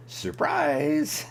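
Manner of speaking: high squeaky "surprise!"; spring it on him, high and bright; mock surprise. Teasing about the peach can.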